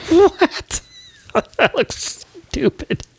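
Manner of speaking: high, squeaky laughing voice, slowing down; helpless laughter. Real, not acted. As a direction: squeeze the words out through real laughter. Cracks up at his own build.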